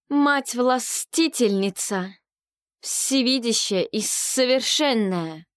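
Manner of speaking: slow, high ritual invocation; invoking priestess. Acted. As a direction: call out to the goddess slowly. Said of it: "взывала жрица".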